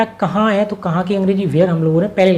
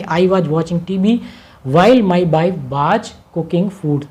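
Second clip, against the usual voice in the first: slow dictation; much slower than usual. Shown as a demonstration. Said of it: "teacher reads translation".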